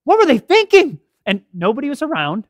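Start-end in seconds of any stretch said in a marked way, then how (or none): 0.0-1.0 high, loud exasperated outburst; pitched up in angry astonishment; retold anger. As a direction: act out her indignant disbelief. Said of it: "she was angry. She was like".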